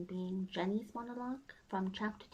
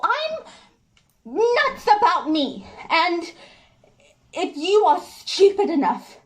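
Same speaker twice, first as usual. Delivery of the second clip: triumphant, defiant self-praise, high and loud; Jenny; 8 semitones higher, 19 dB louder than usual. Acted. Jenny warns George not to throw her aside, exultant defiance. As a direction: ring it out proudly, punching each phrase.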